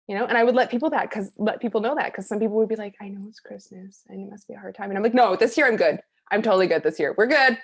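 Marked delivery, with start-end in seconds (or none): 3.0-4.9 hushed, tiptoeing condolence; very soft mock-consoling tone; imitated careful sympathy. Imitating people offering careful sympathy. 5.1-7.6 loud, upbeat brush-off; retold insistence on being fine; emphatic reassurance.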